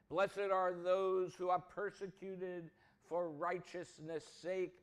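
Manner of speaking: ordinary talk, nothing marked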